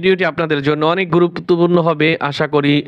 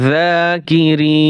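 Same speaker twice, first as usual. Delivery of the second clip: slow drawn-out reading; much slower than usual. Shown as a demonstration. Teacher to students, showing how to blend the syllables, slow chanted reading. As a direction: stretch every syllable, very slow and sung out. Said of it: "বানান করে পড়বেন".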